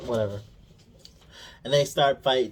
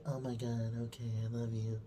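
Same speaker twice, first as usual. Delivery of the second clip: soft tender goodbye; 11 dB quieter than usual. Retold or imitated. Voicing Leia tender goodbye.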